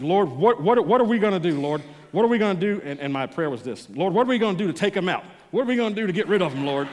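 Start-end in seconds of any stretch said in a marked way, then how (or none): 0.0-2.8 raised, higher pleading cry to the Lord; desperate prayer. Retold or imitated. Pastor crying out to the Lord about an abusive coworker, raised and pleading. 3.9-6.9 fast, raised and higher, half-joking fury; heated prayer. Retold or imitated. Pastor confessing he prayed God would take the man out, fast and heated. Retold honest prayer for revenge.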